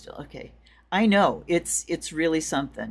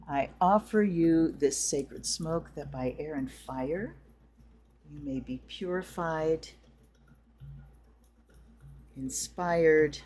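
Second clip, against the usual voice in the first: ritual invocation. Acted. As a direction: intone it like a ceremony. Leads a smoke, water and bell ritual.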